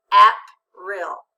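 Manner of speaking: "April" misread as APP-rill, short a, first syllable very loud; accent on first syllable. Shown as a demonstration. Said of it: "Read it with the accent".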